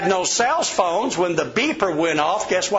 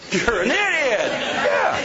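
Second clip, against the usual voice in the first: higher, laughing mock jab; mock insult; 8 semitones higher, slower than usual. Imagining bashing a customer's choice, laughing mock insult.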